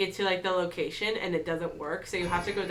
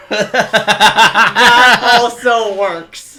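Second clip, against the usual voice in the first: laughing; 2 semitones higher, 17 dB louder, much slower than usual. Real, not acted. Laughing through the banter.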